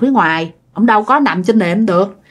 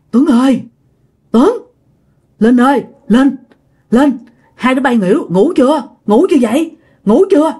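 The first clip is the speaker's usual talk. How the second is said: hushed-panic urgency, mildly acted, slightly raised pitch; urgent, scared calling; 3 semitones higher than usual. Uncle calling the sleeping couple upstairs, urgent and scared. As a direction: imitate an anxious night-time call in short bursts. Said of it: "giọng của ổng á là nghe có vẻ gấp gáp hơn, cái kiểu sợ hãi hơn".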